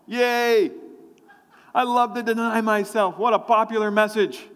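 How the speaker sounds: high, put-on cheer; mock enthusiasm. Preacher feigning delight at an unpopular message, sarcastically cheery.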